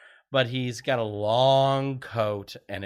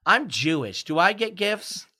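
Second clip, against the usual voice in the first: put-on high-pitched kid voice asking a question; mock little kid's question, pitched way up; 8 semitones higher, 4 dB louder than usual. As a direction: imitate a child piping up, rising at the end. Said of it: "those little kids go".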